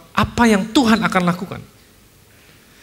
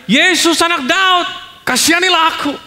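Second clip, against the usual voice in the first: crying out for mercy; 8 semitones higher, 4 dB louder than usual. Acted. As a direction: call out desperately to Jesus over the crowd. Blind man cries to Jesus.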